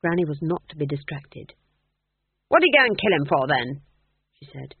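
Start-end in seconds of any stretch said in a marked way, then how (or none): 2.5-3.8 put-on old witch demanding an answer; indignant demand. A narrator's reading. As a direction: press the question, affronted. Indignant Granny pressing her question.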